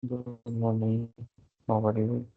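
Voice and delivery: faint, low voice; speaking too softly to be heard; barely audible. Real, not acted. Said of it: "Your voice is very low".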